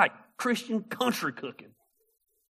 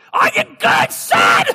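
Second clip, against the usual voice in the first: much higher, loud, rasping shout; preacher; 12 dB louder, slower than usual. Retold or imitated. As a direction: rasp it out like a hoarse preacher. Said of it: "He finally says".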